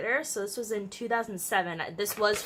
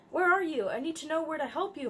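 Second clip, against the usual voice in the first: urgent; 7 semitones higher than usual. A narrator's reading. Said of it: "trying to help".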